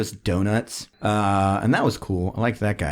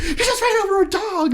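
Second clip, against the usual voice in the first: much higher, incredulous; kids accusing. Retold or imitated. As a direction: fling the accusation.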